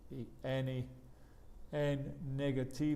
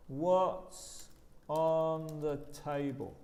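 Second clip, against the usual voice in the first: slow model question; 2 semitones higher, much slower than usual. Shown as a demonstration. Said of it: "teacher repeats slowly".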